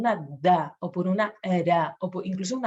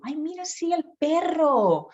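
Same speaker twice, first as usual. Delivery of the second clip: modelling the right word; 9 semitones higher than usual. Shown as a demonstration. Parent correcting the child's word, high and tender. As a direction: coo el perro back to the child. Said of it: "y tú".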